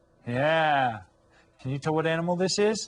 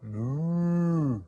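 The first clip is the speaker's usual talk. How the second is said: mock cow moo; 2 semitones higher than usual. Imitating a cow.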